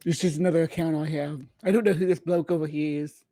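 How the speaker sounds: ordinary delivery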